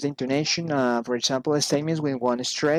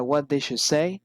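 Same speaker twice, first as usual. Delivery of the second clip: "say" lifted at the end; rising intonation. Shown as a demonstration. Teacher shows polite interest, rising intonation on "say". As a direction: let "say" climb as a polite question. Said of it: "raising in-, intonation".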